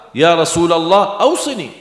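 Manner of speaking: high, loud pleading voice; pleading companion. Acted. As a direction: beg the Prophet eagerly. Sahabat memohon dengan suara tinggi. (sahabat memohon dengan suara tinggi dan keras)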